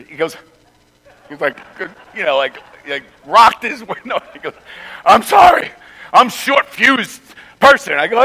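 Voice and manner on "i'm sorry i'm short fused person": higher, louder voiced apology from the other man; imitating the other driver's sheepish apology. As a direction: play the sheepish hothead saying sorry.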